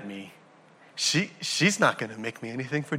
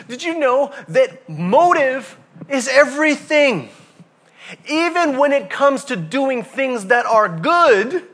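much higher and louder, slow; preaching peak; 10 semitones higher, 6 dB louder, slower than usual. Acted. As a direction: lift it high and slow to the crowd.

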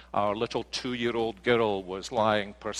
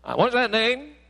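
high, louder puzzled asking; puzzled caller; 12 semitones higher, 5 dB louder than usual. Retold or imitated. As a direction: ask like a confused clerk on the phone. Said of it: "And they say".

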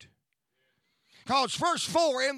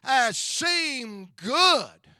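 "As seemed good" slow and louder, every word weighed; slow emphasis; 7 dB louder, much slower than usual. Shown as a demonstration. Preacher making the congregation hold the phrase, slow and loud. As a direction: slowly hammer home "As seemed good". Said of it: "get a hold of this phrase".

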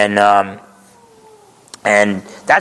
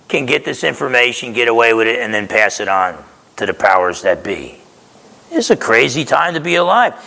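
ordinary talk, nothing marked

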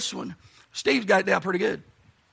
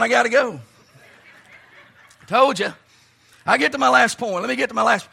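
ordinary talk

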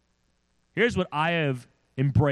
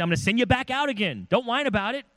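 high, firm sendoff; brisk commanding voice; Jesus, firm; 11 semitones higher than usual. Retold or imitated. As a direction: push them back out with firm urging. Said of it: "He's gonna say".

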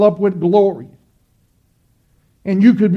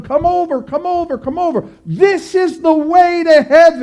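much higher, calling out to lure; mock enticing yell; 7 semitones higher than usual. Broad-road crowd yelling to believers, high mock calling. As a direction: call them over like a barker. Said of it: "people on the Broadway yelling".

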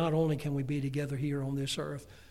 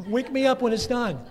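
higher and louder, mock-indifferent; hammed-up bored dad; 8 semitones higher, 9 dB louder than usual. Preacher imitating himself at Mark's birth, mock-bored. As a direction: toss it off like a jaded father. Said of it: "I went".

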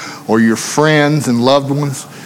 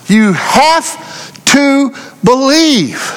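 high, fervent preaching, slowed on the key lines; preaching at full force; 8 semitones higher than usual. Acted. Preacher urging the congregation that faith is key, fervent. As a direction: drive home that faith is the key.

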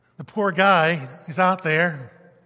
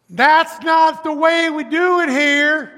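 high, loud mocking voice of old-timers; put-on voice of a stubborn congregation; mock stubborn church member; 10 semitones higher, 9 dB louder, slower than usual. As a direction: sneer it like a set-in-their-ways deacon.

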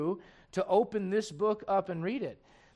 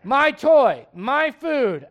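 put-on selfish toddler; 2 semitones higher, 10 dB louder, much slower than usual. Nursery kids asked about mom, loud mock child. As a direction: play a small kid who only wants his toy. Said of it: "ask those kids how their mom's doing".

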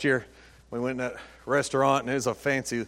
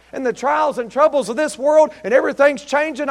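ordinary talk

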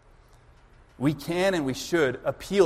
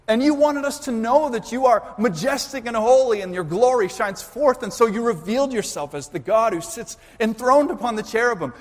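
higher, louder pleading with God; impassioned plea; 6 semitones higher, 3 dB louder than usual. Acted. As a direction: cry out to God, reminding Him who He is. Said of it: "He's, he's saying".

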